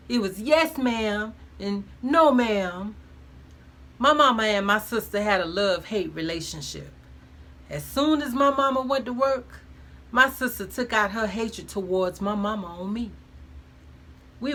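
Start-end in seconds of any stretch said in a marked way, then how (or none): none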